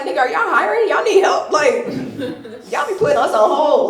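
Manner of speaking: loud, high sarcastic complaint; acted indignation. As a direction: berate the operator for the wait. Berates emergency line about hold.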